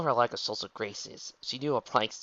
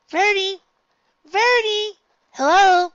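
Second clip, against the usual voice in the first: very high, loud call; 15 dB louder than usual. Acted. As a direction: holler to get a friend's attention, pitched way up. Newcomer calling out to two ponies.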